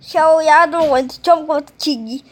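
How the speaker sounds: ordinary talk, nothing marked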